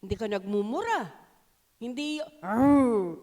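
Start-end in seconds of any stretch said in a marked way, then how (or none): 2.4-3.2 mock tiger roar, loud; tiger growl. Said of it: "para kang tigre". (parang tigreng umuungol)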